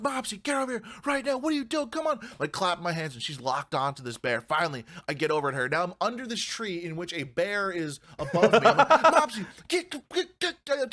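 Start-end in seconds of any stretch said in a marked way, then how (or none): 0.0-2.2 high, urgent call to a runaway dog. Retold or imitated. Owner yelling at his dog Mopsy locked on a bear, high frantic call. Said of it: "I'm screaming". 8.9-10.9 high, laughing shoo at the dog; frantic shooing. Retold or imitated. Owner under the bear's tree shooing his dog, laughing high panic.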